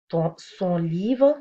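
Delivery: « son livre » lent et un peu fort; détacher « son livre » lentement; slow example. Shown as a demonstration. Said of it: "on va revoir ces exemples". (exemple lent « son livre »)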